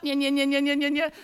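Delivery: loud, rapid nya-nya chatter; imitated shrew noise. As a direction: imitate a trapped shrew's insistent racket. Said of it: "I hear this".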